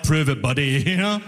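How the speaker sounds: normal talking voice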